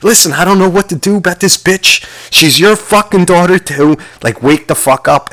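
hammed-up yelling at him; imitating an angry yell. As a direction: rant at the grave like the furious woman. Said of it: "trying to fucking wake this guy up and be like".

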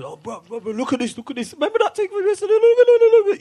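high, eager look at this; hyped friend showing a clip; excited friend. Retold or imitated. Retelling excited friend showing video.

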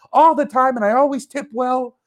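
high, pushier repeat to the driver; insistent repeat. Retold or imitated. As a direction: hammer home how often I order. Pleading with driver.

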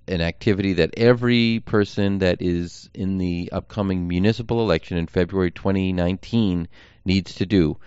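usual speaking voice

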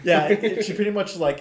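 usual speaking voice

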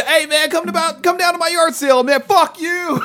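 high, fast mock voice of the yard sale guy; squeaky put-on voice, laughing through it; imitating the yard sale guy.

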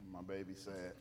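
very low, soft cooing; soothing baby talk. Acted. Preacher comforts a sad baby, low soothing baby talk.

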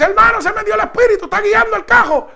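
retold thrilled yell about the car driving itself; excited cry. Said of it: "Y le dice".

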